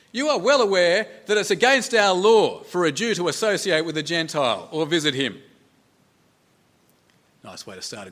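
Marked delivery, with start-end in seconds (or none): 0.1-5.4 loud, higher-pitched pronouncement that settles towards the end; loud declaration. Acted. Peter addressing the centurion on entering his house, loud declaration. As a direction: announce it forcefully, as a rule being laid down.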